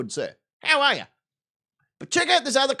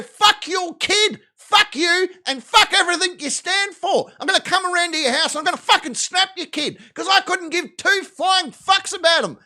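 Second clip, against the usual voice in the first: mock furious rant; 6 semitones higher, 3 dB louder than usual. Captain mock-yells at his best friend about his kid, hammed-up fury. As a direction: rage at him over the kid photo, in jest.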